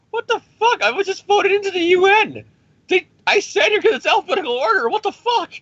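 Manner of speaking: mock-indignant, very high-pitched voice; indignant outburst. Singled-out UN delegate protests to Iron Man, high-pitched indignation. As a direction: squeal it in comic disbelief, voice way up.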